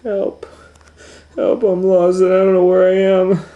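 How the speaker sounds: high, loud panicked wail; crying for help. Acted. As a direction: cry out lost and scared. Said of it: "sat down on a rock and cried".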